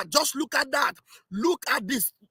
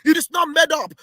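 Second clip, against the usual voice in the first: high, exultant rally-speech proclamation; impassioned excitement; 6 semitones higher than usual. Real, not acted. Supporter proclaiming the leader foresaw it all, impassioned. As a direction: proclaim it like a fired-up preacher. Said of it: "why am I sounding this way".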